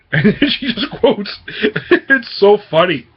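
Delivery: real laughter breaking through the words; laughing. Real, not acted. Reviewer tickled by a funny quote, laughing.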